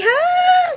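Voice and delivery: loud laugh. Real, not acted. Laughs after pickup line.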